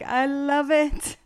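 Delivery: replaying her own delighted reaction; giddy. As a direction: savour every word like a fan. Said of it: "And I'm like".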